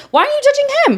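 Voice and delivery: high-pitched mock indignation; incredulous, high-pitched comeback; indignant retort. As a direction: challenge the critics, voice shooting way up. Acted retort to [name]'s critics.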